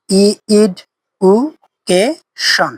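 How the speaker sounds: এডুকেশন broken into ইড, উ, কে, শন with pauses between; split into syllables. Shown as a demonstration. Teacher breaking education into four separate syllables for students. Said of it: "অর্থাৎ চারবার উচ্চারণ করতে পারি।".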